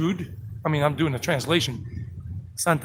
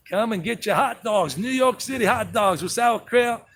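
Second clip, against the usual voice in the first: higher-pitched New York vendor patter; New York accent; 5 semitones higher than usual. Pretend. Street vendor selling hot dogs to passersby, New York accent. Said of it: "I can't do the New York City accent anymore".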